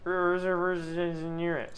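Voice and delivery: words running together; slurred speech. Real, not acted. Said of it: "Sorry, I'm slurring my words".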